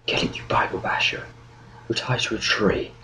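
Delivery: hushed scolding; voiceless, secretive urging; irritated whisper. Acted. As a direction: whisper impatiently at a dim companion. Captive secretly urging fellow captive.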